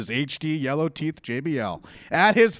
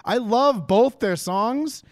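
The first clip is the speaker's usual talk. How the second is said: ordinary talk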